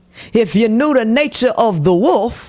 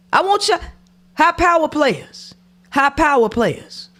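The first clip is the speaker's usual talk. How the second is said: calling out; 9 semitones higher than usual. Real, not acted. Calling out to listeners.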